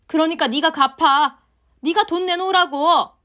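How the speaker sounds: shrill, loud scolding mother-in-law; angry mother-in-law. Acted. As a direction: berate her daughter-in-law at full volume. Mother-in-law berates her. (화난 시어머니의 고함)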